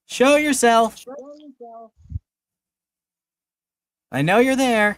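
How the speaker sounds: loud, high call into the night. Acted. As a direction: shout out to someone hidden. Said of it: "starts shouting".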